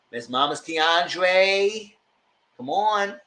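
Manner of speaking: very slow, drawn-out "Miss Mamas Keandre, come on"; mocking drawl. Real, not acted. Speaker teasing Keandre with his nickname, slow mocking drawl. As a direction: stretch the nickname out to tease him.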